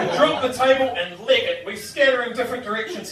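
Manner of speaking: ordinary talk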